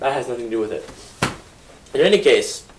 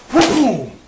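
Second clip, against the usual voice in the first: gunshot boom; 9 semitones higher, 4 dB louder than usual. Acted. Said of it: "they turned around and went".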